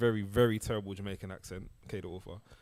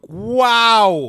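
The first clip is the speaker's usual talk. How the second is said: loud mock wow; 11 semitones higher, 15 dB louder than usual. Real, not acted. Mock amazement at conquests.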